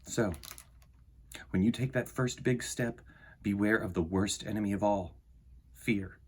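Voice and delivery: soft, measured storybook reading; reading to children. Acted. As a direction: read it gently to a young audience, letting lines land. Reads picture book aloud.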